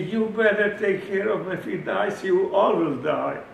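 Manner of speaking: loud menacing warning; stern threat. Retold or imitated. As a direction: threaten him, heavy and loud. Threatens doctor.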